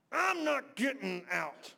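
loud, slow declaration of resolve; determined shout. Acted. Preacher shouting his vow to the church. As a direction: plant your feet and shout it out.

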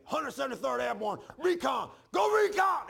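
loud, high chant building to a shout; retold yelling. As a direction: imitate a brother hollering a unit's war cry. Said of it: "He's yelling at, in the phone".

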